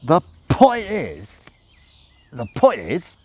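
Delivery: put-on loud, high, slow drunken repetition; a drunk struggling to find his point; drunk Crowley. Crowley drunk, trying to focus on Aziraphale. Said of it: "he tried to focus on Aziraphale".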